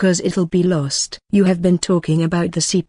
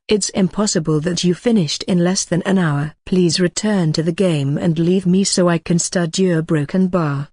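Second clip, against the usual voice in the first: usual speaking voice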